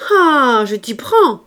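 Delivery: loud, high shout of catching her out; a furious shout at the child; angry shout. A narrator's reading. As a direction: bark it out, caught red-handed. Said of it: "cria-t-elle".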